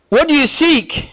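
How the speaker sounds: high, slow, ringing question from Jesus; Jesus asking. Retold or imitated. Preacher voicing Jesus asking the disciples, high and slow. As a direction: ask it as Jesus would, drawn out. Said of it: "He says".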